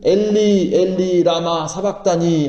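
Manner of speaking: drawn-out solemn cry to God; imitated anguished call, long vowels. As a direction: recite it as a slow, restrained cry from the cross. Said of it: "큰 소리로 말씀하시기를".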